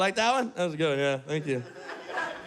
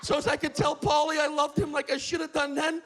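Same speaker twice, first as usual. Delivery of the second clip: put-on shouting old man; 6 semitones higher than usual. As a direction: rant at the trees, pitch way up. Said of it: "He's just shouting it".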